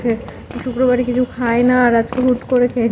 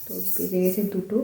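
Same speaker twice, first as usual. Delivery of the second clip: hushed late-night voice, much softer; speaking softly; 4 semitones lower, 13 dB quieter than usual. Real, not acted. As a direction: keep it down so nobody wakes. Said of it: "আমি আস্তে আস্তে কথা বলছি".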